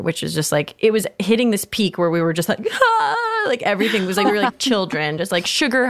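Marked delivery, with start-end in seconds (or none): 2.7-3.4 high laughing-crying squeal of overwhelm; excited squeal. Retold or imitated. At Disneyland, overwhelmed while building her lightsaber, a high laughing cry. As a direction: let out a giddy, teary shriek.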